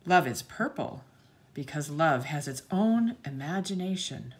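put-on bright, sing-song read-aloud for children; lilting picture-book narration; storybook reading. A narrator's reading. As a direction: read the page like to a class of little kids.

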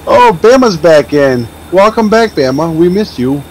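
normal talking voice

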